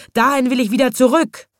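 high, loud, determined lion cry; lion shouting. A narrator's reading. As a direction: shout your demand as the storybook lion. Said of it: "schrie der Löwe". (laut rufender Löwe)

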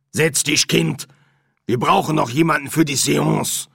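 put-on firm, loud instruction to a child; bossy medium mother. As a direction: order your daughter to the table. Medium orders her daughter.

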